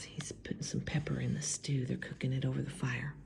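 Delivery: fast hushed whisper. Real, not acted. Whispered aside.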